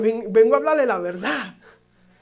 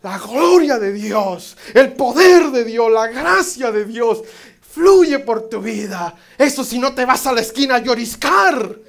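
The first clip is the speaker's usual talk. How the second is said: loud, slow, emphatic preaching; full-force preaching; 3 semitones higher, 10 dB louder, slower than usual. Acted. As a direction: proclaim it to the whole church.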